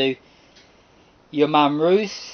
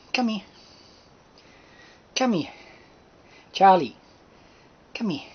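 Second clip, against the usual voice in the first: coaxing a wild bird; 8 semitones higher than usual. Real, not acted. Calling the shy bird.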